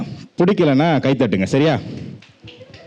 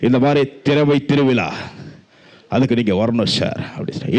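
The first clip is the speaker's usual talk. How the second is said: ordinary talk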